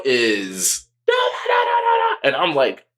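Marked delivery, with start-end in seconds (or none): 1.1-2.2 high unvoiced mock shout; mock yelling. Drogo going off on dude, high mock shouting. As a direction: rant like Drogo tearing into him.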